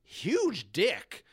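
ordinary delivery

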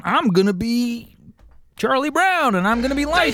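Charlie Brown voice. Retold or imitated. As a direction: imitate a kid playing Charlie Brown. Kids doing Charlie Brown voice.

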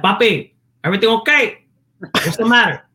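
put-on high Puerto Rican dad accent; dad's Spanish accent. Son demonstrating his dad checking on him, high Spanish accent. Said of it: "you would hear the accent".

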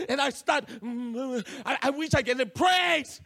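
ordinary talk, nothing marked

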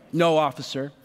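low, deadpan sarcasm; sarcastic. Retold or imitated. Teen answering the cop about the soda can, sarcastic. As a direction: drop the pitch and state the obvious dryly. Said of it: "with as much sarcasm as I had left".

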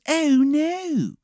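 high-pitched, hammed-up Maryland voice; bad Maryland accent; horrible Maryland accent. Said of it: "how bad this guy's fucking Maryland accent is".

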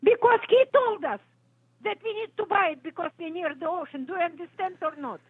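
high, shrill, exasperated yell; furious customer yelling down the phone. Real, not acted. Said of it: "You better stop yelling at me".

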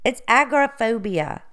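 agoraphobia said as AG-ra-phobia; stress on first syllable. Real, not acted. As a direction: say agoraphobia with AG stressed, middle O dropped. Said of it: "putting the emphasis on the wrong word".

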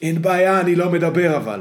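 a lively mock Broza. Broza's retold reply.

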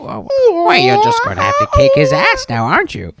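high put-on villain voice; movie villain goading the hero, high and mocking; mock taunting character. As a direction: taunt him in a silly high voice.

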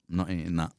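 low, soft quoted aside; retold muttered refusal. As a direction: imitate a mate quietly refusing under his breath.

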